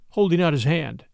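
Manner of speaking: ordinary delivery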